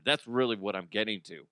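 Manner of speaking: ordinary delivery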